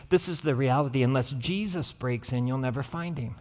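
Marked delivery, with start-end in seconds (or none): none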